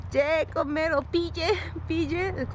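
ye and pille said high, set apart; teaching Polish words. Shown as a demonstration. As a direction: spell out ye and pille as words to learn. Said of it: "Si tú dices".

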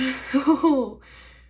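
loud squealing fan laugh; giddy loud laugh. Real, not acted. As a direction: break into delighted laughter. Giddy over the silver hair.

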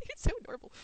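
high, loud adoring squeal; high-pitched, tickled delight; gushing. Real, not acted. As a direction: gush over something adorable, laughing. Gushing over Canadian accent.

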